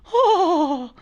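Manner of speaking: high startled "Oh."; Attila's gasp. Retold or imitated. Imitating the driver as cars pass, high startled gasp. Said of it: "Attila's going".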